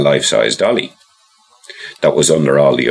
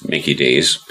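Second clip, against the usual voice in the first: ordinary delivery, nothing marked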